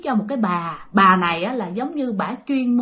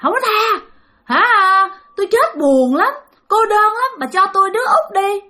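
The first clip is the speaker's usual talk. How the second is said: high ghostly demand; refuse, then press the demand as a lonely spirit; insistent high-pitched ghost voice; stubborn refusal; 9 semitones higher than usual. Acted. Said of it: "Bà cứ không chịu".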